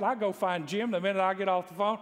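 ordinary talk

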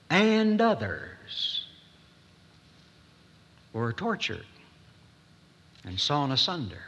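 drawn out slowly, pausing long between phrases; slow solemn reading. Retold or imitated. Preacher reading Hebrews aloud to congregation, slow and solemn. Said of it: "He says it twice".

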